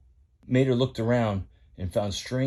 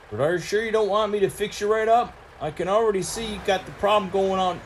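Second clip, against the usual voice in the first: higher, twangy country tow truck; lightly pitched-up country boy voice; folksy tow truck Mater; 9 semitones higher, 3 dB louder, faster than usual. Acted. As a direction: play Mater the rusty tow truck, eager to spill news.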